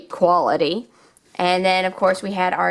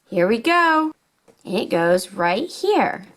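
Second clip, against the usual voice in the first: put-on upbeat, sing-song storytelling for little kids; toy play narration; 7 semitones higher than usual. Showing toys to young viewers, upbeat and sing-song. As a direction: play along like a kids' toy show host.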